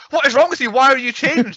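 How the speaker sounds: hammed-up sudden outburst, pitch leaping up; mock outrage. Girlfriend turning on her boyfriend instantly, mock outrage. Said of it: "she turns on him instantly".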